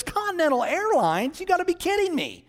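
high, disbelieving squeal at the airline; incredulous scoff. Acted. Beautician mocks the customer's airline, high incredulous scoff. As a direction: scoff in disbelief, pitch shooting up.

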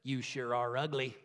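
low, quiet deadpan parrot; parrot's insult. Acted. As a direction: drop it low and soft, rude parrot. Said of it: "the parrot looks at her and says".

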